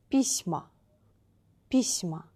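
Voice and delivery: письма stressed on и, falling; stress on first vowel. Shown as a demonstration. Teacher gives the plural example, first-vowel stress. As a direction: say PISma, weight on the start. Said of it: "first vowel should be stressed".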